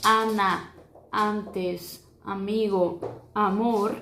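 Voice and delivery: Ana, antes, amigo, amor with a wide open a; open Spanish a. Shown as a demonstration. Teacher demonstrating the open Spanish a to students. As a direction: open the a fully in each word. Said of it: "ela é um pouquinho mais aberta".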